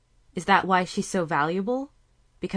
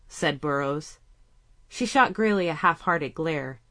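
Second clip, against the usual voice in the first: ordinary delivery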